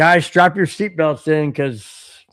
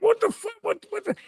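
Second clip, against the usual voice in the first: very high mock panic; acted-out squeaky alarm; freaking out; 5 dB quieter, faster than usual. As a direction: stammer it in a falsetto panic. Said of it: "I'm freaking out".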